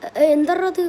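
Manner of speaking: shocked stammer. Acted. Shocked by his own voice.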